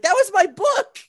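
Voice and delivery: high, loud upset cry; indignant yell. Retold or imitated. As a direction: yell it like your book just sank. Joe upset his book sank.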